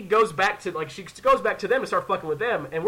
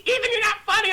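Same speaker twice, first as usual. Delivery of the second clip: high-pitched mock complaint; mock a scared friend's scolding; whiny scolding; 13 semitones higher, faster than usual. Hannah scolding the joker, whiny.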